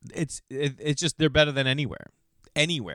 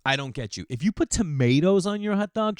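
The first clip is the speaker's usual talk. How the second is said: ordinary delivery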